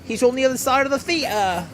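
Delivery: high, mocking British accent. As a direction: parrot her British accent with a sneer. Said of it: "Dude, yeah, her accent".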